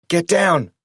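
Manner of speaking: sharp, high urgent command; urgent hiss. A narrator's reading. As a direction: bark it out in terror. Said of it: "the taxi driver hissed".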